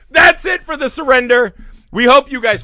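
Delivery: loud, high sign-off call; outro shout. Real, not acted. Hosts wrapping up the episode for listeners, a loud high sign-off. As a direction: announce the wrap-up with energy.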